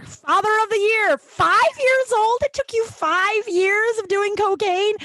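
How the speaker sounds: high, drawn-out mock acclaim; sarcastic applause. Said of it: "like, slow clap".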